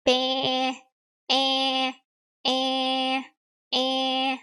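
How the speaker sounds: EH held long and level; goat-like vowel. Shown as a demonstration. Teacher links goat sound to vowel, EH stretched long. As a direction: stretch EH out like a goat's bleat. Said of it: "It's like that".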